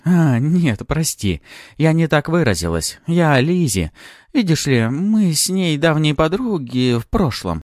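high, loud, ringing woman's voice; light-hearted backtracking. A narrator's reading. Woman hastily correcting her slip to Maxim, high and ringing. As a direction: brightly brush it off, pitched high. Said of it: "звонко рассмеялась".

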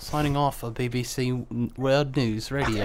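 British newsreader. Pretend. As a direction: sign off like a BBC presenter. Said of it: "doing British accents".

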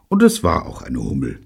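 ordinary delivery, nothing marked

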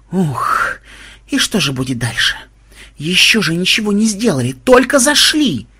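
panting, breathless grumble; out of breath. A narrator's reading. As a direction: grumble while catching your breath. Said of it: "тяжело дыша проворчал Ксенобайт".

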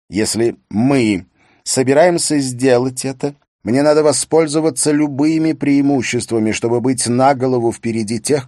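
ambitious husband. Acted. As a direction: press the case to your wife. Husband presses his political ambition.

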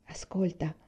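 soft, whispered command; hushed, secretive prompt. A narrator's reading. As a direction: whisper it so they listen. Father hushes child to hear music.

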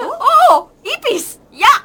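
loud, high cry of disgust; disgusted yell. Acted. Girl shrieking in disgust at the idea of a cockroach. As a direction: shriek it like a cockroach just flew at you.

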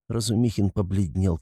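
ordinary delivery

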